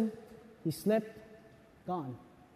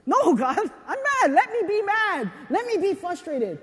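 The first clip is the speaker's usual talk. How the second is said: mock angry protest; 6 semitones higher than usual. Abel refusing God's command, frustrated and raised. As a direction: refuse God, let the frustration out. Abel voiced arguing with God.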